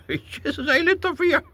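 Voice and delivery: high, loud gleeful squeal; squealed defiance. Real, not acted. Defying his father's advice, a high gleeful squeal. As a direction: shriek it with delight, laughing.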